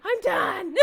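mock despair cracked up with laughter; laughing despair. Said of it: "I was just like".

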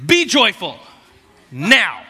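loud, barked command to be joyful; mock stern command, loud; bossy order. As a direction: order the joy like a drill sergeant. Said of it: "He doesn't say".

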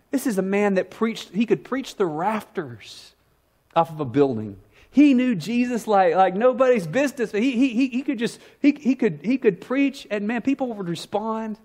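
fervent, high-pitched pulpit build; building preacher fervor. Acted. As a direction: preach it up to the rafters. Preaching at full force.

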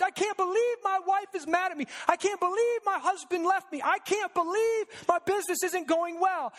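high, whiny self-pitying lament; whiny mock complaint. Preacher imitating complaining churchgoers, high and whiny.

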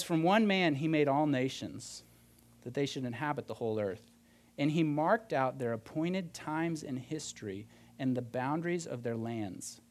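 slow, measured Bible reading, falling low at phrase ends; scripture reading. Acted. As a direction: read the verse out slowly and deliberately. Reads a Bible verse aloud.

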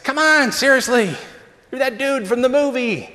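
high-pitched mock pleading; mock insistent. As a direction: needle him like a cheeky fan. Pressing Costner playfully.

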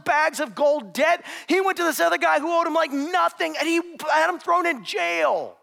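preacher pressing the point home; preaching at full force. Acted. Preacher pressing the story to the congregation, forceful.

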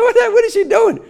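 high-pitched, startled retelling; startled bewilderment. Retold or imitated. Husband watching his wife run upstairs, startled bewilderment.

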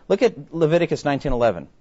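usual speaking voice

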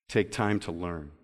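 slow quote reading with long, weighty pauses; measured, low-pitched and unhurried, each clause left to sink in. Real, not acted. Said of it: "I'm going to read it slow".

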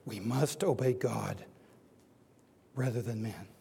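low and measured, with weighty pauses; solemn declaration. Acted. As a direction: let each phrase settle quietly. Apostles' answer, read solemnly.

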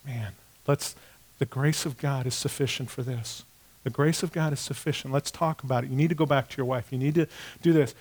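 gentle counsel. Retold or imitated. Pastor gently counseling the ashamed gambler to go home. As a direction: quietly reassure him, then urge him on.

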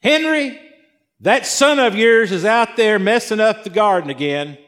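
high-pitched mock nagging; exasperated parent. Parent complaining about that son of yours, mock exasperation. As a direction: call your spouse out over the child. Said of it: "is that phrasing in your house?".

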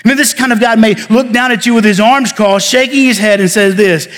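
ordinary delivery

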